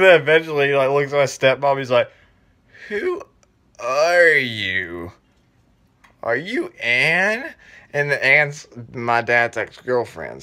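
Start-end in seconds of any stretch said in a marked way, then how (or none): 2.9-7.5 slow, stretched-out questioning; mock-bewildered, drawing out each question; dazed and confused. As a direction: act like you can't place who this is. Dazed patient not recognising stepmom.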